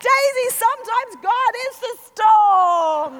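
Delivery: loud, high yell through a storm; shouted lesson. Retold or imitated. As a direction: bellow it to a child in the wind. Yelling lesson at child in gale.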